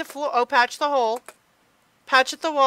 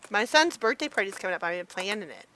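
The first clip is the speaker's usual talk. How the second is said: ordinary talk, nothing marked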